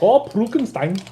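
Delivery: high, loud mock German accent; thick German accent. Shown as a demonstration. Friend playfully saying an odd nickname, thick German accent. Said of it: "saying it with a thick German accent".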